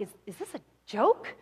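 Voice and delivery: low, halting disbelief, acted; stunned. Boss hearing his businesses are gone, acting stunned and low. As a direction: stammer it quietly as if lightly dazed. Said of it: "he's stunned".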